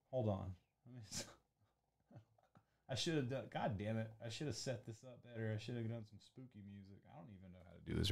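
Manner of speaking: very soft, off-hand grumbling to yourself; hushed self-reproach, half to yourself; muttered aside. Real, not acted. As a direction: grumble quietly while fiddling with something. Fiddling with setup, muttering to himself.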